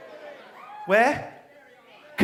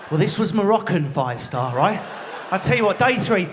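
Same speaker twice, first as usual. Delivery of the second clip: ordinary talk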